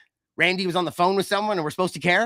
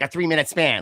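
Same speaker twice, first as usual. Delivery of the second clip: ordinary talk, nothing marked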